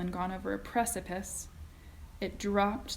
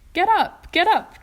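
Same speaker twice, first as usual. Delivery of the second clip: high, loud call to get up; cried out; 11 semitones higher, 12 dB louder than usual. A narrator's reading. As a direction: shout it over the noise. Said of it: "cried Mister Wonka, roaring with laughter".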